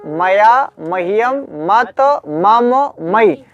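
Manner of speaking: "मया, मह्यम, मत, मम, मयि" each separated; one form at a time. Shown as a demonstration. Teacher demonstrating single forms, "मया" to "मयि" spaced apart. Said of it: "एक बार एक एक रूप पढ़ना चाहिए".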